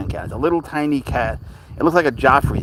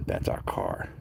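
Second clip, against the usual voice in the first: ordinary talk, nothing marked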